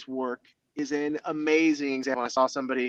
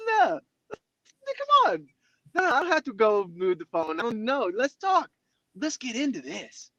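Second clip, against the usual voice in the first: much higher, bright and coaxing, inviting the voice to stay; eager welcome; 12 semitones higher than usual. Retold or imitated. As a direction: chase after the critic voice, all eager and playful. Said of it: "we say".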